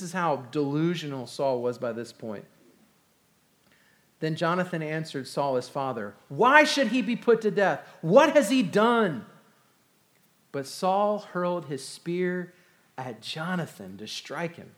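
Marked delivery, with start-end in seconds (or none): 6.3-9.3 pleading protest. Acted. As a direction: cry out in disbelief for a friend. Jonathan pleads with his father.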